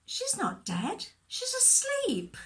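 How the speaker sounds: slow, high-pitched insistence; drawn-out, emphatic reassurance; emphatic correction. Acted. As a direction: set them straight, voice leaping on the last words. Jesus corrects the mourners.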